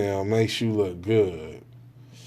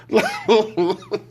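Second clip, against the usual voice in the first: high laugh; 8 semitones higher than usual. Real, not acted. Laughs at own bit.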